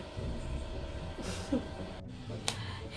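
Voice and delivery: quiet unvoiced giggle; soft breathy laugh. Real, not acted. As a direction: let a breathy laugh slip out. Laughing after telling the story. (risada baixinha e soprada)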